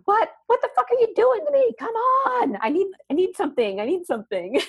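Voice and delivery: very high-pitched frantic demand; squealed, hammed-up outburst; mock screaming. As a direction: act out pain screaming for attention, easing slightly by the end. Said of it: "it just starts screaming at ya".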